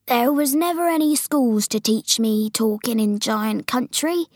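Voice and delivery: usual speaking voice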